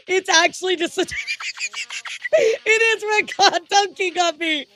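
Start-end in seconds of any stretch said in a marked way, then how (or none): 1.0-4.7 high cracking laughter through the words; breaking up with real laughter; laughing hard. Real, not acted. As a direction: laugh helplessly while getting the line out. Laughing at Red Claw joke.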